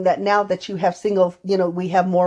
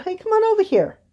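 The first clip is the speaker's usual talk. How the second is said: put-on high, friendly call to a child; coaxing call; 13 semitones higher than usual. Therapist calling the little boy over, high coaxing call.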